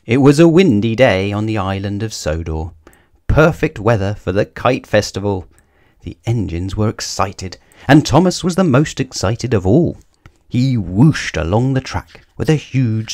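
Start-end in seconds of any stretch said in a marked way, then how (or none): none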